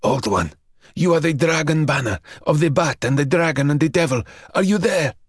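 rising, fast and urgent; a young man calling anxiously to the old one. Acted. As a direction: call out, pitch climbing in urgency. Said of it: "he called out urgently".

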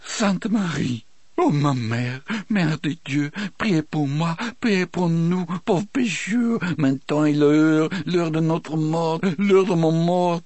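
high, pleading, tearful prayer. Acted. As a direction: pray through tears, voice high. Del's tearful prayer before execution.

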